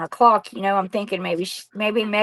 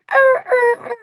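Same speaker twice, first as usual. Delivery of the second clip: high whiny dog cries, much higher and louder; imitated dog crying; 5 dB louder than usual. Imitating the little dog crying at the door, high whine.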